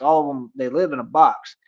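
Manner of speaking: ordinary talk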